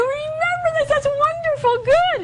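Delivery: very high, thrilled voice; high, gushing teacher delight; delighted high praise. Shown as a demonstration. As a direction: gush over the child remembering.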